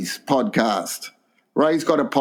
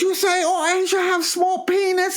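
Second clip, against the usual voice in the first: very high, indignant mock Chinese accent; [name]'s Chinese accent. Offended mate confronts the host, exaggerated Chinese accent. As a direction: complain in a squeaky put-on Chinese accent. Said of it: "you're doing the accent".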